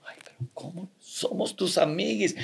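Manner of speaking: soft breathy whisper; mock whisper. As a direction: whisper it like a friend. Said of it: "Y decían".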